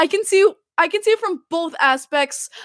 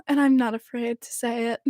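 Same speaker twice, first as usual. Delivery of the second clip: ordinary talk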